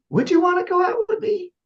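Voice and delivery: high doubtful tone. Shown as a demonstration. Coach shows a doubtful guy asking for a date. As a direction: ask her out full of doubt. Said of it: "I'll use this in a dating scenario".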